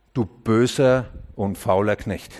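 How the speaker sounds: low, slow, weighty rebuke; stern rebuke. Acted. Jesus answers the servant's excuses, stern and low. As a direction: pronounce the verdict slowly, deep down.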